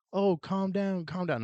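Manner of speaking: mimicking nagging faculty, high-pitched; mock calming. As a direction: parody the staff telling him to calm down. Faculty urging calm, mocked.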